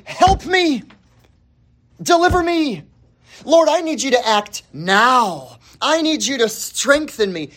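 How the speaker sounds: high, urgent cry for help; pleading prayer. Acted. Believer praying to the Lord for help, pleading. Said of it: "like you and I praying".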